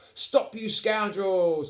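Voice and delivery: slow, high, loud captain's command; shouting. A narrator's reading. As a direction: call out like an indignant pirate captain. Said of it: "Captain Eight Piece shouted".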